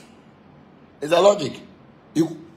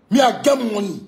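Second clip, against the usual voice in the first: repeated boast, higher and a bit louder; 6 semitones higher, 3 dB louder than usual. Real, not acted. Ranting at a rival, repeating the boast loudly. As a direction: hammer the boast home again.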